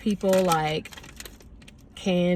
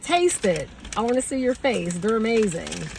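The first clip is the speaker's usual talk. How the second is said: louder, higher, excited retelling; eager urging; 4 semitones higher, 3 dB louder than usual. Retold or imitated. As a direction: urge her eagerly to try it. Eagerly urging her to taste.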